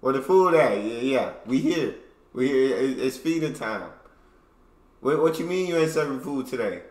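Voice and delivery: loud cocky bird voices demanding food. Pretend. Hungry cockatoos tapping the window demand breakfast, loud and cocky. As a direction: play the hungry cockatoos calling out their feeders. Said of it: "They knocking on the window".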